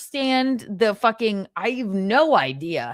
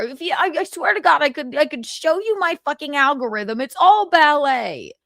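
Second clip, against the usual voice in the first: usual speaking voice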